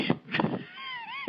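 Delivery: a high, rising burst of laughter. Real, not acted. Amused at his own story about Derek, high laugh. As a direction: break into a high giggle.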